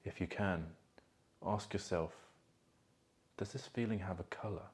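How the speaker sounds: soft, calm meditation voice with long pauses; guided meditation. Acted. Coach guiding listeners to feel emotions in their body, guided meditation. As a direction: guide them gently inward, leaving space between phrases.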